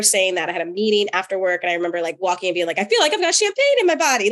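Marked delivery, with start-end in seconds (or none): 2.8-4.3 quick and higher, fizzing with excitement; giddy excitement. Retold or imitated. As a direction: bubble over like an uncorked bottle. Retelling own giddy excitement.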